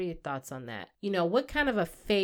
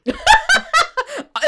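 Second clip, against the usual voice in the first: loud high laugh; 9 dB louder than usual. Real, not acted. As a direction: burst of shrieking laughter.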